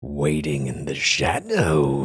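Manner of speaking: low, drawn-out creepy narrator; slow, sinister and hammed-up; mock ominous narration. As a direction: loom like a horror storyteller. Mock menacing villain narration.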